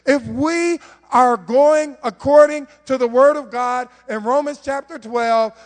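ordinary delivery

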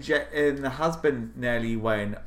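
normal talking voice

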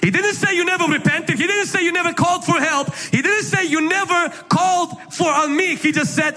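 high, shouted repeated didn't; fiery preaching. Acted. Preacher driving home his point to congregation, shouting. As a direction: build the sermon to a shout.

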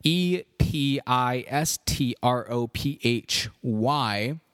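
slow, letter by letter; spelling out. Shown as a demonstration. Podcast host spelling the horse name for listeners, spelling out. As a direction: spell it out slowly, each letter separate. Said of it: "how I spelled it".

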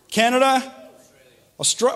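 ordinary talk

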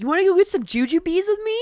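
high, loud, fast goofy dork voice; goofy guy. Retold or imitated. Retelling a dorky guy's offer, high fast goofy voice. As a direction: play him eager and clueless.

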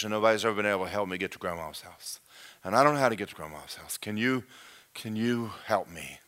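low, hushed, hurried pleading; tiny lost creature mumbling a low, fast plea; discouraged little creature. Acted. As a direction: run it together softly, losing hope. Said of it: "he's more discouraged than ever".

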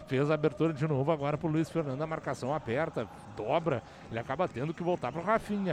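rapid radio football narration; play-by-play. Real, not acted. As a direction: call the match live, brisk and steady.

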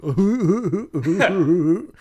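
high, loud wobbly goofy hoot; dopey, high-pitched "hoo-hoo-hoo"; goofy impression. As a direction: hoot loudly like a big goof. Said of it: "I did an impression".

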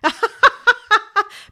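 high loud giggle; shrieking laugh; giggling burst. Acted. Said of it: "wouldn't stop laughing".

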